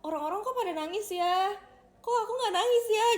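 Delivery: high, loud bewildered inner voice; mock-baffled, high and loud; puzzled wondering. Voicing own puzzled thoughts as child.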